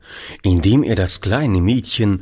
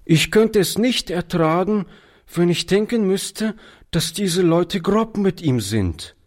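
high-voiced, mildly tearful plea; understated upset of a pleading boy; near tears; 10 semitones higher than usual. A narrator's reading. Boy fears police will mistreat the child, near tears. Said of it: "dabei kamen ihm die Tränen".

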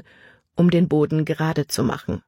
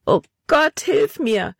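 put-on a girl calling for help in the dark; desperate cry; 9 semitones higher, slower than usual. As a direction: cry out to God in despair. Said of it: "rief sie verzweifelt".

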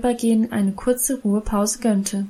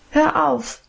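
unruly outburst; 5 semitones higher than usual. A narrator's reading. Little girl interrupts Emma's Bible reading, sharp protest. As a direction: cut her off like a stubborn girl. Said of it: "fuhr ich ungebärdig dazwischen".

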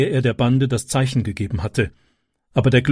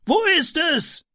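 much higher, urgent call from above; neighbour calling down. A narrator's reading. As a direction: shout down from the window. Neighbour searching.

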